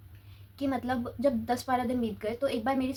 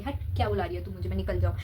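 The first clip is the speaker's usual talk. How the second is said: fast, lower, mock-rude snap at the aunt; rude dismissive brush-off; 4 semitones lower, faster than usual. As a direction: toss it off like a rude kid ignoring an elder. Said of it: "disrespect करके ऐसे ignore करके". (बदतमीज़ी से झिड़कते हुए, तेज़ और नीची आवाज़ में)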